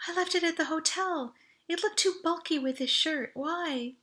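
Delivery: a lightly voiced young woman, casual; Audrey's voice.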